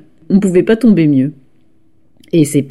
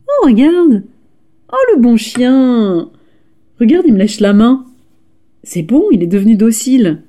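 squeaky praising a dog; 5 semitones higher than usual. Acted.

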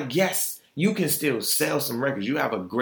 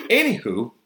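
ordinary talk

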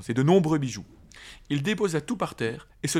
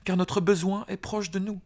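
urgent call; 8 semitones higher than usual. Acted. Frodo calls Tom for help.

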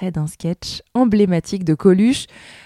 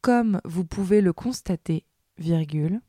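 dictating; 6 dB quieter than usual. Acted. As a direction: read it out like a school dictée, word by word. Dictée read aloud with punctuation.

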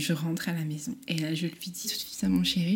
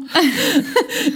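laugh; 11 semitones higher, 9 dB louder than usual. Real, not acted. Laughs before telling story.

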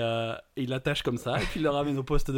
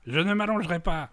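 put-on higher-pitched drunk defiance; stubborn refusal; 7 semitones higher, 5 dB louder than usual. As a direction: play a drunk digging in. Ivrogne refuse de s'allonger. (refus buté d'ivrogne)

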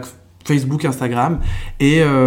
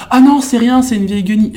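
higher, louder, brushing off a compliment; self-deprecating reply; 8 semitones higher, 6 dB louder than usual. Retold or imitated. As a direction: wave it away like it's nothing. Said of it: "c'est comme quand je te dis".

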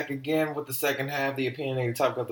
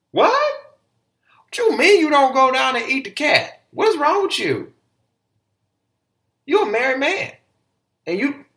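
much higher, loud, slow squeal of disbelief; outraged disbelief; 9 dB louder than usual. Retold or imitated. Host reacting to Khaled's answer, shrieking in disbelief. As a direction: shriek it like you can't believe what you heard.

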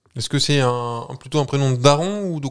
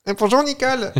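much higher, sing-song hello to an unseen Nicole; mock cheery greeting; 14 semitones higher than usual. Guest asked by the host to greet Nicole, mock cheery. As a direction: greet her like an old friend across the street. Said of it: "Tu lui dis bonjour ou pas?".

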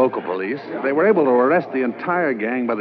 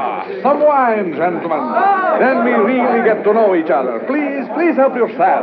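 hearty host; 9 semitones higher, 5 dB louder than usual. Acted. Host toasts the gangsters' success.

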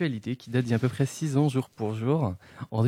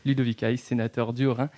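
ordinary delivery

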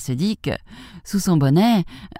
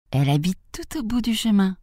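lightly voiced little girl, pitched up; 6 semitones higher than usual. A narrator's reading. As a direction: answer as an innocent child, higher. Child's line voiced in tale.

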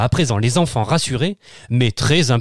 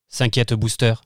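plain reading voice

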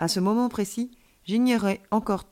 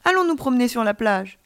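higher, louder, eager proposal; enthusiastic; 4 semitones higher, 5 dB louder than usual. A narrator's reading. Alien child invites friend to the beach, enthusiastic. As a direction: burst out with the invitation. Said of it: "il ajouta avec enthousiasme".

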